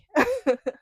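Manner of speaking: a high burst of laughter. Real, not acted. Amused by her younger self, high laugh. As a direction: laugh at the memory. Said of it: "laugh after retelling".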